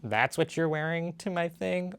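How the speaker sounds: catty high-school snipe, lightly voiced; snide teenager. Trump mocked as a teen about Zelensky's clothes, snide. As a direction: sneer like a teen at a party. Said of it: "he's acting like a teenager in high school".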